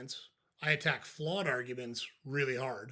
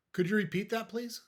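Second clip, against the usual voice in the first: higher-pitched polite question, rising through please; matter-of-fact; 8 semitones higher than usual. Shown as a demonstration. Coach models answering an insult, matter-of-fact. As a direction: ask it plainly, with a high lift at the end. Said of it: "Just very matter-of-factly".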